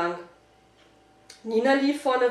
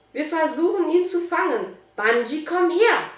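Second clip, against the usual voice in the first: raised, urgent voice; excited calling; 7 semitones higher, 4 dB louder than usual. A narrator's reading. Boy trying to catch his dog, excited calling. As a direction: call out eagerly, worked up. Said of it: "rief Dominik erregt".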